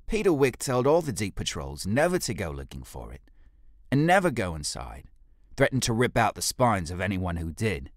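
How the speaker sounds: cheeky, boastful reply to his leader; cheeky ape guard. Acted. Fekis retells warning.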